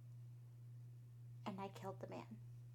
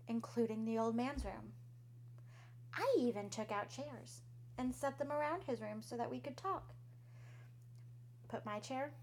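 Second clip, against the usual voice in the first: usual speaking voice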